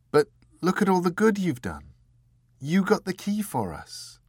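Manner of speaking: put-on high, light girl's voice, gently comforting; young Dor consoling. A narrator's reading. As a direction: lift the voice up for Dor and soothe him.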